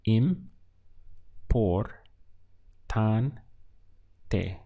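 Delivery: im, por, tan, te split with pauses between; syllable by syllable. Shown as a demonstration. Teacher breaking the word into syllables for the class. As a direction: tap out each syllable of importante. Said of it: "Let's count the syllables".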